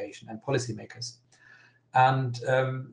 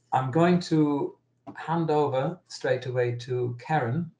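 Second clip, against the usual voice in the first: normal talking voice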